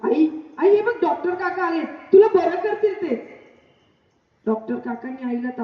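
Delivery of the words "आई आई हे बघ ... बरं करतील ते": joyful calling. Acted. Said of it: "आवाज दिला".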